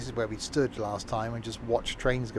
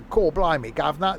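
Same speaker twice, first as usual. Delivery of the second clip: hammed-up Cockney accent, higher and louder; an American's cartoon Cockney accent; mock Cockney accent; 5 semitones higher, 8 dB louder than usual. Mimicking Americans doing a British accent, loud mock Cockney. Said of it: "hear Americans do the British accent".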